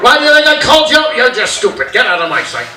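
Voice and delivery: loud dismissive dad, starting high and fast; mock-angry rebuke that drops in pitch; scolding dad. Dad sends son away, angry scolding.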